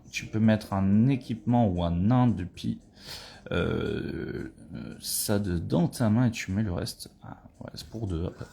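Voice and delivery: usual speaking voice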